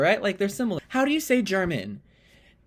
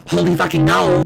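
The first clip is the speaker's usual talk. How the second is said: very loud cry of disbelief; shocked outburst; 2 semitones lower, 11 dB louder than usual. Acted. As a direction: blast it out in exasperated shock. Acted shock at failed guess.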